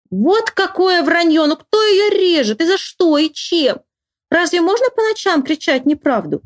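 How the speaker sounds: put-on high-pitched boyish indignation; indignant protest. A narrator's reading. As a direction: object in outrage at an obvious lie. Boy protests at the false cries.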